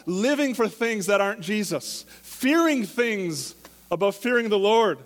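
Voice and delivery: loud, high preaching, driving the point home; preaching at full force. Acted.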